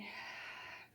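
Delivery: long breathy release sigh; audible sigh. Shown as a demonstration. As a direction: let the breath fall out with a sigh. Teacher models a relaxing exhale.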